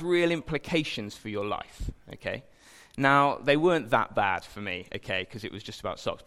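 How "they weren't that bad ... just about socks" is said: ordinary talk, nothing marked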